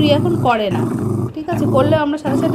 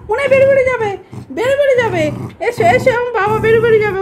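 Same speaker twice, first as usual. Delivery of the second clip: high, sing-song pet talk; 10 semitones higher than usual. Real, not acted. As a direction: coax the dog in a high cooing voice. Baby talk to the dog about going out. (উঁচু গলায় আদুরে ভাষায় কুকুরকে ডাকা)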